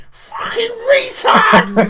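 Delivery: drawn-out teasing yell of the co-host's name; mock shouted intro. Host introducing his co-host with a mock slow yell. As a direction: bellow the insult slowly, hamming it up.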